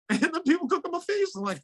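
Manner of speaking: ordinary talk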